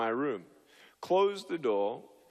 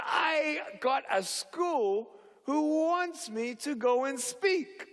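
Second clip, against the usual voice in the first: excited news; 10 semitones higher, slower than usual. Retold or imitated. Telling brother the big news.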